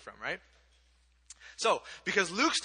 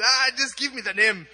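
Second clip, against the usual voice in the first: loud, higher Kenyan lady voice; imitated Kenyan-accented exasperation; 5 semitones higher, 7 dB louder than usual. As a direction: give up impatiently in a put-on Kenyan accent. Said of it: "I'll do the accent".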